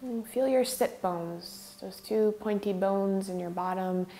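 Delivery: measured meditation lead with long pauses; guided meditation. Real, not acted. Yoga teacher leading students in regulating the breath, guided meditation. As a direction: guide them unhurriedly, leaving space between phrases.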